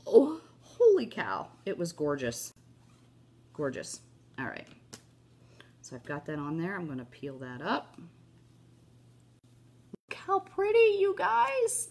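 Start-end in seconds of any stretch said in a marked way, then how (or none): 0.1-1.5 high, slow wow; amazed gasp. Retold or imitated. Messaging Jackie about her creation, amazed. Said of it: "and I was like". 9.9-11.9 much higher, louder gushing; excited squeal. Real, not acted. Showing viewers her craft, thrilled squeal.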